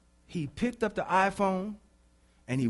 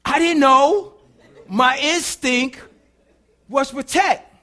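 loud, high, emphatic self-defence; full-force preaching; 10 semitones higher, 12 dB louder, slower than usual. Real, not acted. Preacher defending the yell at the child, full-force preaching. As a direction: punch it out to justify yourself.

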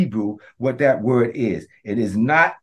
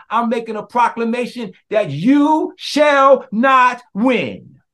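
proclaiming; 8 semitones higher, 4 dB louder, slower than usual. Shown as a demonstration. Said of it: "I'm telling you right now".

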